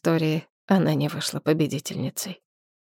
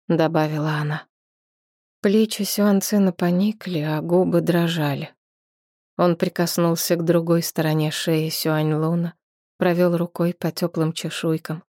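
ordinary delivery, nothing marked

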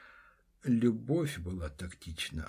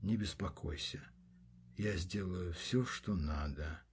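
soft, low reassurance; softly spoken; 4 semitones lower, 7 dB quieter than usual. Acted. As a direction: say it gently and quietly. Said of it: "тоже негромко проговорил".

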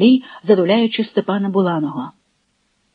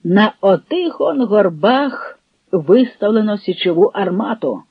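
plain reading voice

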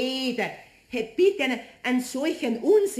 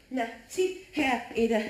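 usual speaking voice

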